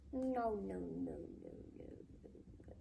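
from soft to a voiceless whisper; no, no fading into a whisper; fading denial. A narrator's reading. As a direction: let each no die away. Father realizing his mistake, trailing off.

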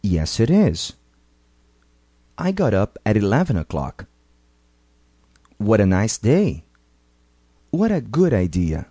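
final T flapped into the next vowel, got up and at eleven run together; linked flapped T. Shown as a demonstration. Teacher models linking for learners, flapped T. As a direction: let the T tap lightly into the next word. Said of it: "Ouça alguns exemplos e depois repita".

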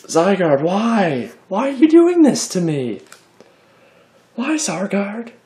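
high, loud mock despair at the card; mock anguish. Collector whining at a disappointing card, mock anguish. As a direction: plead with the card, hammed-up.

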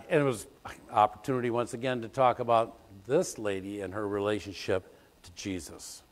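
ordinary talk

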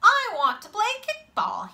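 put-on high-pitched kid asking to play; eager child. A narrator's reading. Boy asking other kids to let him play kickball, high and eager. Child asks to join the game.

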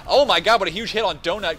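loud, high yell of amazement; excited shout. Real, not acted. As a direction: blow up at the huge hit. Huge hit in live cast.

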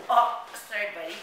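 ordinary talk, nothing marked

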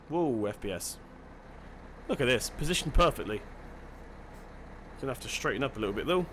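usual speaking voice

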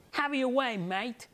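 put-on a British girl storming off; British accent. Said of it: "My so-called ridiculous British accent".

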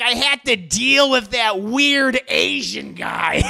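hammed-up disgruntled workman; mock griping worker. Worker gripes, streamer mimics him.

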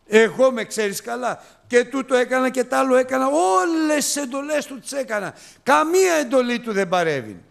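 high, loud, fast complaint; resentful elder son. Acted. Preacher voicing the elder son to the congregation, high and aggrieved. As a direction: whine like the jealous brother.

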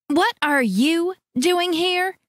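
high, slow, sharp demand. A narrator's reading. Sister confronting sister, outraged demand. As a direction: confront her sister head-on, indignant. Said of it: "Teresa demanded".

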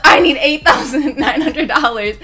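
loud, high plea through laughter; mock-frantic begging, high and loud; retold panic. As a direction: laughingly reenact a desperate demand. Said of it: "I was just so scared".

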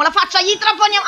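high, loud battle cry; frenzied resolve. Real, not acted. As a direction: shout it in a rush of adrenaline. Player attacks killer with drill.

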